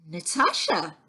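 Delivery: a touch of surprise in calling her name; gently taken aback at the sight of her; amazed. A narrator's reading. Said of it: "says her father in amazement".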